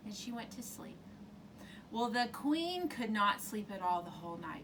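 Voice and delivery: ordinary delivery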